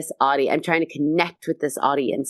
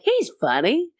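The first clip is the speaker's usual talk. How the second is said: high, questioning swoop; puzzled, pitch leaping up as if asking; doubtful audience member; 10 semitones higher than usual. Retold or imitated. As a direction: imitate an unconvinced onlooker, high-pitched. Audience unsure whether host is funny.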